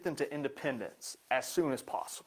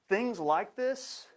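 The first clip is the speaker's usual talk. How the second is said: slow emphasis; 7 semitones higher, much slower than usual. Shown as a demonstration. Drawn-out emphasis.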